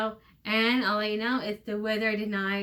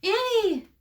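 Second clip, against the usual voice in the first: excited yell; 10 semitones higher than usual. Acted. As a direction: burst out with joy. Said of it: "He yelled her name excitedly".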